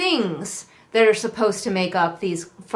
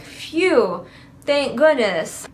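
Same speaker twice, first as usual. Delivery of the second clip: higher, drawn-out pretend sigh of relief; mock relief; 4 semitones higher, much slower than usual. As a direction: play up being let off the hook. Acting out Isla's relief.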